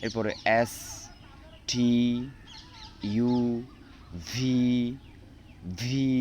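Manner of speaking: reciting the alphabet. Shown as a demonstration. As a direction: drill the letters one by one, clearly. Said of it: "আমি অ্যালফাবেটগুলো পড়ি".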